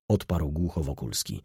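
ordinary delivery

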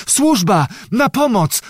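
high, urgent calling out; desperate cry for help. A narrator's reading. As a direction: call desperately for the servants. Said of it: "wołała rozpaczliwie kniachini". (rozpaczliwe, wysokie wołanie o pomoc)

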